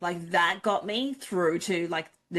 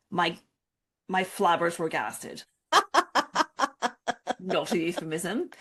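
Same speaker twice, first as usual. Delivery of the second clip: ordinary delivery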